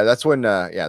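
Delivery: usual speaking voice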